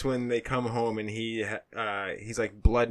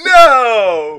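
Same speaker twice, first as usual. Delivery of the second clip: loud, high No through laughter; loud laughing no; laughing protest; 10 semitones higher, 16 dB louder than usual. Real, not acted. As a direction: cry out in mock horror.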